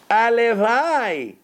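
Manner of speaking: a drawn-out heartfelt wish; longing sigh of a wish. Real, not acted. Preacher to his students, a long yearning הלוואי. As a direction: stretch הלוואי out slowly with yearning.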